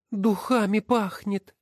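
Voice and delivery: high, slow, amazed woman's voice; surprised cry. Acted. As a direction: marvel at the smell. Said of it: "с удивлением воскликнула". (удивлённо воскликнуть, высоким голосом)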